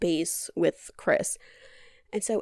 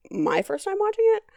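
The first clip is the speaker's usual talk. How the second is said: excited emphasis; 13 semitones higher, 4 dB louder, faster than usual. Real, not acted. Bragging she's seen it before.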